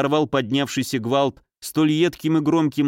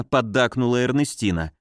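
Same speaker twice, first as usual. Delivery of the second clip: ordinary delivery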